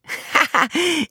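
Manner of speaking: high bright mocking laugh; girlish laugh. Zora laughs at the jailer from the window. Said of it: "lachte sie".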